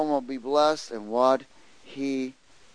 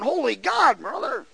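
high, loud insistent reply; emphatic retort; 12 semitones higher, 6 dB louder than usual. Retold or imitated. Preacher retelling his answer to the biker, loud and high. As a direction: push it back at him. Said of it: "And I'm going".

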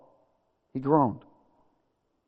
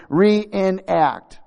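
"Reenact" drawn out in separated syllables, higher and louder; word broken down; 6 semitones higher, 5 dB louder than usual. Shown as a demonstration. Preacher repeating "Reenact" for congregation, emphatic separated syllables. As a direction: hammer out "Reenact" slowly, part by part. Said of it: "Do y'all get it?".

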